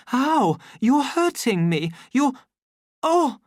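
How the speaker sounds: high, loud yelps of pain; startled pained cries, breaking off; cry of pain. A narrator's reading. Lenina to the savage gripping her, high pained cries.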